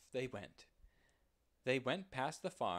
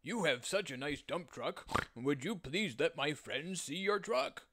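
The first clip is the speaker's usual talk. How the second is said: higher, louder pig voice; pleading piggy voice, pitched up; 5 semitones higher, 4 dB louder than usual. Acted. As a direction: pig eagerly asking a favour. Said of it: "said the pig".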